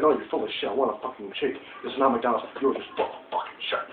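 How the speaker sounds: low, muttered, almost whispered insult; quiet mock telling-off; hushed retold retort. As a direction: mutter the complaint under your breath. Retelling telling off Burger King staff.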